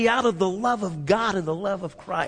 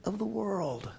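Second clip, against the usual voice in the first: tender reminder; 2 semitones higher, 5 dB quieter than usual. Acted. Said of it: "please remember who you are".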